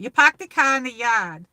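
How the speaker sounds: a quick demo of a Boston accent for the chat. Shown as a demonstration. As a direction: show off a Boston accent, a little hammed up. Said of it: "I can do a Boston accent".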